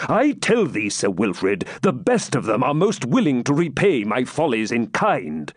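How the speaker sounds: high, emphatic royal rebuke; testy king turning the reproach round; impatient. A narrator's reading. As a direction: argue back impatiently. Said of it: "answered Richard impatiently".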